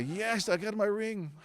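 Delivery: slow, high, fed-up "Yes, I got my ring"; mock annoyed wife. Wife tired of her husband asking about the ring, drawn-out and fed up. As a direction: drag it out like an exasperated wife. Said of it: "my wife is so annoyed with me".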